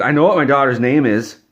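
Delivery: a mother snapping at the tester; angry mother. Retold or imitated. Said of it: "She was so angry".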